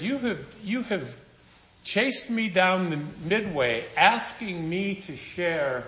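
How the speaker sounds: rising indignation at being chased down; confronting the evangelist, building high and slow; retold indignant rebuke.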